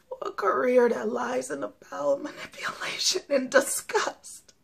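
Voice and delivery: hushed, broken whisper of despair; tragic heroine sorrow. Acted. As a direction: whisper it like everything is lost. Despairing confession after long pause.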